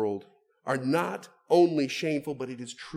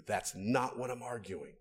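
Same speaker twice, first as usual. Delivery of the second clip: ordinary talk, nothing marked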